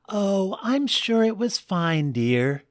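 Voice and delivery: high, soothing wifely tone. Acted. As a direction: reassure him gently as the wife. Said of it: "said Tina".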